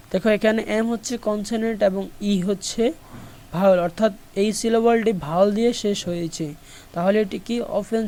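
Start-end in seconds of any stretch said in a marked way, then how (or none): none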